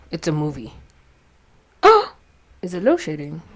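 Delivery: ordinary talk, nothing marked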